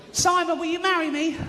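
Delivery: very high mock squeal; giggly audience woman. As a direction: propose in a silly high voice, then giggle.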